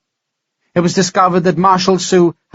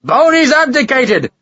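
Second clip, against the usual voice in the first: triumphant shout; 10 semitones higher than usual. Acted. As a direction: bellow the news across the tavern. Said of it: "shouted his news to the whole tavern".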